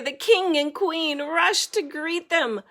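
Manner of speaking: usual speaking voice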